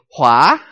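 rising tone on Hua. Shown as a demonstration. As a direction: pull Hua upward. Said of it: "You start at thirty and you go up to seventy".

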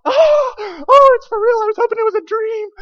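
very high, loud frightened wail; hammed-up terrified squeal; panicked terror. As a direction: shriek it in disbelief and panic. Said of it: "And he freaks out".

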